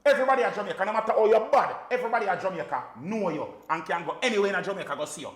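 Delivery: fired-up rant; animated rant. Real, not acted. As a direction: hammer the point home. Animated rant on local selectors.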